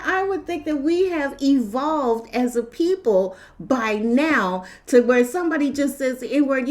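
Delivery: normal talking voice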